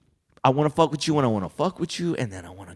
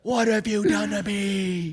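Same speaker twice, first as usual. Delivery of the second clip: high, slow tragic wail; milk the betrayal, slowly; mock melodrama; 8 semitones higher, slower than usual. Friend continuing the bit, high slow mock tragedy.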